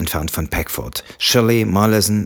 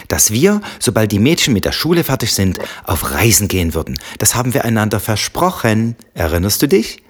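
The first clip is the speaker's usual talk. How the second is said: precise, emphatic, higher pitched; clearly articulated; 4 semitones higher, 4 dB louder than usual. Acted. Tipsy wife reminding husband of their promise, clearly articulated. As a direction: press each word home. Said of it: "artikulierte Samantha deutlich".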